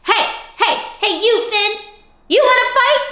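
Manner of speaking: high, squeaky challenge; grouchy ladybug. Acted. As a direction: pick a fight in a tiny voice.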